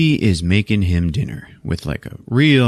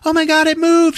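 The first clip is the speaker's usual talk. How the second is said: very high-pitched excited squeal. Retold or imitated. Pregnant woman feels her baby move, acted excited. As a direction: exclaim it high, imitating a thrilled woman.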